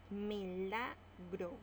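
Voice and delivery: Milagro drawn out slowly; slow example word. Shown as a demonstration. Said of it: "Lo separamos en sílabas". (Milagro dicha despacio)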